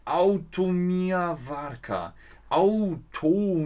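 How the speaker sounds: drawing out the foreign words very slowly; slow sounding-out. Real, not acted. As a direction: puzzle out the strange name syllable by syllable. Liest fremdes Schild vor. (langsam und fragend buchstabiert)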